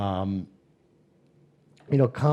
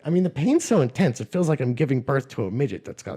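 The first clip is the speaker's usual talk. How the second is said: ordinary talk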